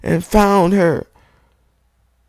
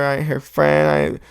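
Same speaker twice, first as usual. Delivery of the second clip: ordinary delivery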